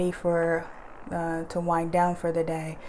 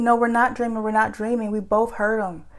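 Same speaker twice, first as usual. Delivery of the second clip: insistent protest; 4 semitones higher, 6 dB louder than usual. Retold or imitated. Children protesting to mom.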